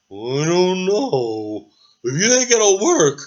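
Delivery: slow, lightly voiced unsure dragon; a hint of a hesitant dragon; lightly voiced dragon mulling it over; timid dragon. Dragon answers the knight.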